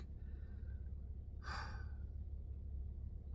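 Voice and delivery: long, quiet breathy exhale; weary drawn-out sigh. Retold or imitated. Patient asked how he is doing, long weary sigh.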